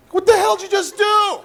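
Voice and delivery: high, loud, horrified demand; outraged yell. Acted. Acted outrage at wife pulling hair.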